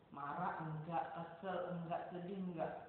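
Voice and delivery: slow, soft choices offered; soft gentle offering. Shown as a demonstration. Teacher offers a silent child feeling words: soft and gentle. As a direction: quietly offer the feelings to pick from. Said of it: "Kalau dia nggak mau nyebut gimana?".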